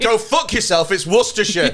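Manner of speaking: half-joking snap of correction; mock irate correction; mock angry correction. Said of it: "it's like".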